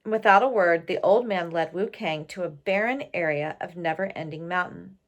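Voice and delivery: usual speaking voice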